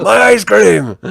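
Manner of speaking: hammed-up despair over a lost treasure; mock anguished yell. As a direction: imitate a cartoon vendor's wail. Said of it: "he's always yelling".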